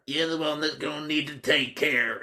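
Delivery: high-pitched nutcase warning. Acted. Nutcase replying to being told to take care, high-voiced warning. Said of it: "He was a nutcase as well".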